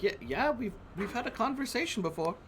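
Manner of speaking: put-on sweet old librarian greeting a visitor; kindly librarian voice. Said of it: "And she's like".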